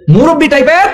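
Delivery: high, rising, incredulous echo; mock shock at what was just heard; startled question. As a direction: repeat it as an alarmed question, pitch shooting up. Startled husband repeats wife's words.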